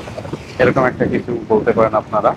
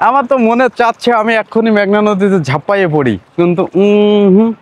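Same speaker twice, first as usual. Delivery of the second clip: high, eager and playful; bursting to jump in; excited; 7 semitones higher than usual. Real, not acted. Longing to jump in.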